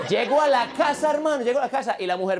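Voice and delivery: high, animated loud telling; worked-up account. Acted. Man telling his pal about getting home to his wife, worked-up. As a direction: pour it out excitedly to a buddy. Acts out boasting about wife's gesture.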